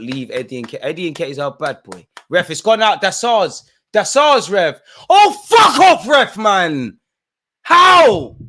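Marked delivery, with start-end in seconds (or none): 2.3-8.4 loud, high outraged shouting; furious yelling at ref. Real, not acted. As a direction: scream your protest at the ref. Raging at referee during match.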